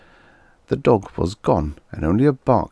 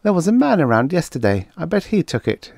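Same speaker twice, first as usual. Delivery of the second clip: put-on high, quick child voice; a small kid blurting it out; young child; 11 semitones higher than usual. Child telling the officer, high and quick.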